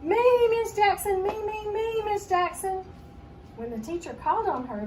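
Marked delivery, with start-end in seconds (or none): 0.0-2.8 high, loud, drawn-out pleading to be picked; eager calling out. A narrator's reading. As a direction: call out begging the teacher to choose you. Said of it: "Libby started waving her hand".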